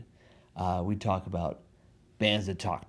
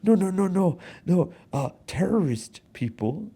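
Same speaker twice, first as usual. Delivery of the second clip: higher, louder imitation of flipping out; retold flustered outburst, pitched high; mock flustered panic; 9 semitones higher, 4 dB louder than usual. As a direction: act her frantically correcting herself. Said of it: "flips out and goes".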